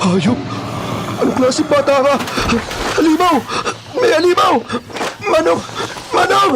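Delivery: terrified screaming. Acted. Kidnapper fleeing the monster child, screaming in terror. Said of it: "halos malaglag ang kaluluwa ko".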